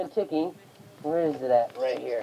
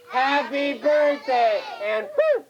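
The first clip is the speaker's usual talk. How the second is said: high, very slow group chant; stretched words, high playful poof; called-out magic words; 7 semitones higher, much slower than usual. Pretend. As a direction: lead the children in a drawn-out birthday spell. Leading children in magic words.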